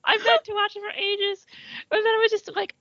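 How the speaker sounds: high-pitched voice through laughter; laughing confession. Real, not acted. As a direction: admit it while cracking up, pitch climbing. Laughing confession of never seeing it.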